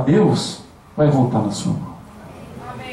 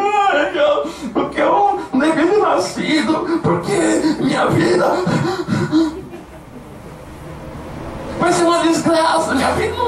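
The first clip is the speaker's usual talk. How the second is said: mock whiny wail, pitched way up; choramingando exagerado; 11 semitones higher than usual. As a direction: mimic a self-pitying crybaby moaning. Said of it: "Fica chorando". (imitação debochada de choro lamurioso, bem agudo)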